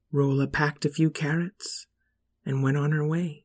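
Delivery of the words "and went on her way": ordinary delivery, nothing marked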